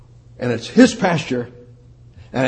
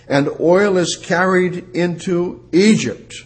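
scripture reading; 5 semitones higher than usual. Acted. Preacher reading Hosea to the congregation, solemn and raised. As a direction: read the verse out like holy writ.

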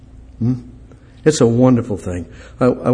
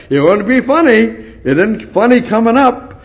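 much higher, insistent preaching; high urgent preacher emphasis; emphatic rise; 11 semitones higher, 3 dB louder than usual. Real, not acted. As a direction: press the point hard, voice climbing. Preacher insists it is not funny.

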